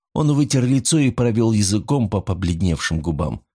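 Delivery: ordinary delivery, nothing marked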